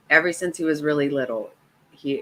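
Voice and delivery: normal talking voice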